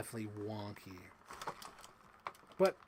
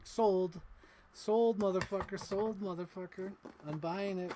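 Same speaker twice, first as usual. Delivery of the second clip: excited; 7 semitones higher, 5 dB louder than usual. Retold or imitated. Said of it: "I'm like".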